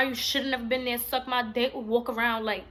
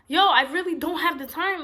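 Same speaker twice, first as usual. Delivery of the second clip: exasperated retort; 5 semitones higher, 7 dB louder than usual. Retold or imitated. Her retort in argument.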